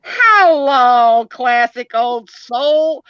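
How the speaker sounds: loud, high, stretched-out hello; imitated greeting. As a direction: draw it out slowly, mimicking her hello. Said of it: "But she's like".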